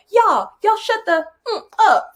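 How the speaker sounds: put-on high, pushy girl voice telling class off; bossy girl. Girl stands up and tells class to shut up, high bossy voice. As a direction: play a blunt schoolgirl shutting everyone up. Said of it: "she stood up, she's like".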